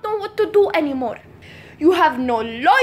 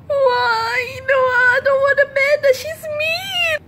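whiny crying; 6 semitones higher than usual. Acted. Charlie dreads mean Amanda.

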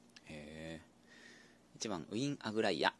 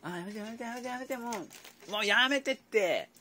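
rising high pleading stop; begging the bird to quit, louder at the end; real protest; 9 semitones higher, 5 dB louder than usual. Real, not acted. Bird splashing water. (水を飛ばす鳥に必死で「やめて」)

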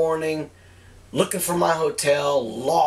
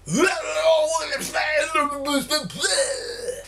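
put-on drunken gibberish; 14 semitones higher than usual. Drunk asking store clerk for directions, garbled gibberish. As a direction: let out a long garbled wail of nonsense. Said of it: "what came out of my mouth".

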